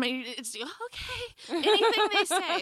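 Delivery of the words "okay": high, soft, smitten reply; dreamy little agreement, pitched up; mock swooning. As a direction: melt at the accent. Swooning at an English accent.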